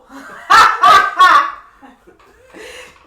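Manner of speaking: high loud cackling laugh; loud burst of laughter. Real, not acted. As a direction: break out laughing hard. Big laugh at joke.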